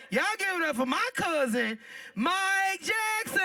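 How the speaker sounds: big drawn-out stage introduction; announcer intro. Real, not acted. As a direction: hype him up and stretch the name. Introducing the next comedian.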